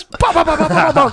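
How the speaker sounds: high-pitched mock punch noises; rapid "bah" punches in a high voice; Mero. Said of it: "And he goes".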